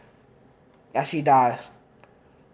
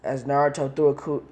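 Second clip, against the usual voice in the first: ordinary delivery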